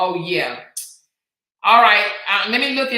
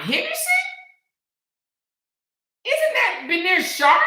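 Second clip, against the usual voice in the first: surprised guess; 14 semitones higher, 3 dB louder than usual. Real, not acted. Guessing towns, incredulous.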